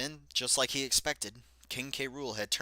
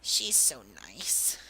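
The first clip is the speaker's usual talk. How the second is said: whispered and slow; slower than usual. Acted. Racer whispering to himself about Daisy, slow whisper. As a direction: breathe it as a secret aside.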